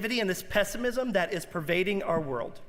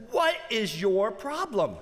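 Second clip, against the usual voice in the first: imitating Louis C K, higher and incredulous; mock a comedian scolding a spoiled passenger; high, worked-up comic lecture; exasperated rant; 6 semitones higher than usual. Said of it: "Louis C K looks at the guy and says".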